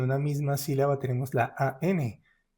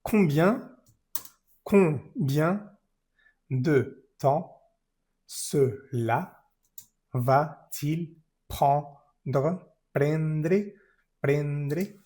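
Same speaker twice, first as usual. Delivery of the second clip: slow chunked reading; much slower than usual. Shown as a demonstration. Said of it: "teacher chunks the question".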